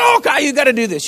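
high, hammed-up pleading cry; mock desperate plea. Preacher acting out jerking people in the audience, mock desperate. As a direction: plead as if grabbing people, overdone. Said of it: "he jerked people and said".

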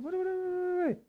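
high, quiet string of waits; soft, high-pitched startled reaction; startled double-take. Retold or imitated. Preacher retelling his Bible discovery to church, startled.

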